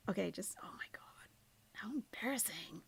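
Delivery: whispered mortified aside; embarrassed whisper. Real, not acted.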